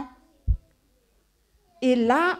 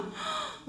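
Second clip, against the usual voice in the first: surprised gasp; 12 dB quieter than usual. Acted. Storyteller reacts to the scene.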